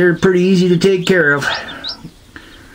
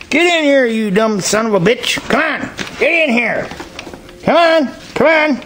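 exasperated yelling; 10 semitones higher than usual. Real, not acted. Farmer yelling at stubborn goats to get in, exasperated. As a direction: holler at the stubborn goats, fed up.

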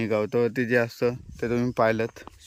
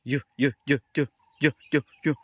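playful repeated high yo calls; calling the dog; 3 semitones higher, 4 dB louder, much faster than usual. Real, not acted. Owner calling his playful dog Kalu, bright yo-yo calls. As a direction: call the dog over playfully.

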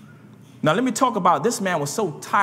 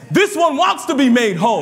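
rising emphatic repetition; 6 semitones higher, 6 dB louder than usual. Retold or imitated. Repetition climbs louder.

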